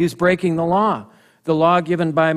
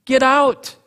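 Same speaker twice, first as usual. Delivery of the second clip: high, loud order to get out; priests' raised rebuke, much higher; bold command; 9 semitones higher, 4 dB louder than usual. Retold or imitated. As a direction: throw the king out with your voice. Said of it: "Then they say".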